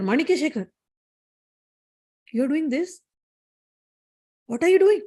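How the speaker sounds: lightly acted goddess confronting her servant; stern reproach. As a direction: demand an explanation, mildly outraged. Goddess confronts the lustful devotee.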